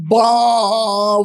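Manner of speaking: long, loud imitated child's bawl; mock baby wail. Imitating a tired child crying at school, long mock wail. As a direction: stretch out a sleepy kid's cry. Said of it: "وہ رو رہا ہے".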